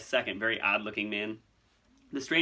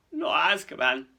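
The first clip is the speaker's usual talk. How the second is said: loud, higher croak of dread; 5 semitones higher, 7 dB louder than usual. Acted. Hagrid realizing he faces Azkaban, croaking. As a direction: croak it out in horror. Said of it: "croaked Hagrid".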